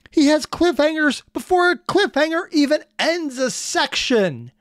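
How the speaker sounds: high, slow, punchy protest; exasperated rant. Real, not acted. Reactor ranting about nested cliffhangers, high emphatic exasperation. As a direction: pitch it way up and hammer each word.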